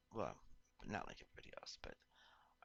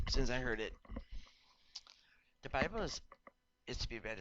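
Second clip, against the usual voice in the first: rising emphatic; 3 semitones higher, 7 dB louder than usual. Real, not acted. Heated point about the Bible.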